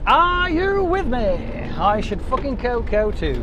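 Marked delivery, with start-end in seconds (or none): 0.1-1.7 high, slow, stretched crowd call; drawn-out rallying call. Pretend.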